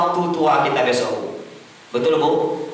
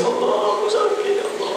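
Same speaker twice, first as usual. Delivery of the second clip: mock crying; 9 semitones higher, 3 dB quieter, faster than usual.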